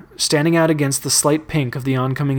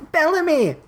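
high-pitched cry of alarm; acted shout with a falling end. A narrator's reading. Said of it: "Octavia shouted".

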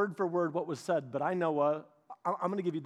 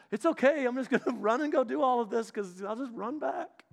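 higher-pitched, carefree mock voice; breezy excuse-making; 5 semitones higher than usual. Careless believer shrugging off mistakes, breezily mocked. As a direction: toss it off like someone excusing every mistake.

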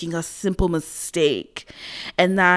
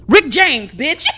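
loud, raised catchphrase impression; 5 semitones higher, 6 dB louder, slower than usual. Woman finally recalling the singer's name, shouted catchphrase impression. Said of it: "[name] was pretending to be [name]".

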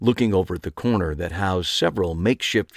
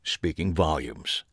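usual speaking voice

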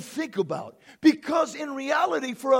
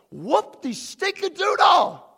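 loud, high, drawn-out scoff; gleefully dismissive shout; scornful holler; 6 semitones higher, 6 dB louder, slower than usual. Real, not acted. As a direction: belt it out to mock the devil. Scoffs at devil's accusations.

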